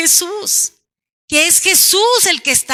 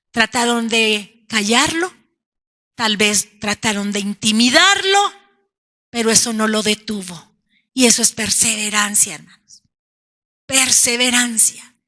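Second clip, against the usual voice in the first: usual speaking voice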